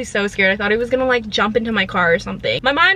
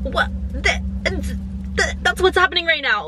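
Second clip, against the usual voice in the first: high incredulous stutter; mock flustered stutter; stunned stammer; 7 semitones higher than usual. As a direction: stammer in disbelief at the creep.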